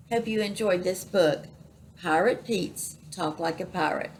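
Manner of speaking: ordinary delivery, nothing marked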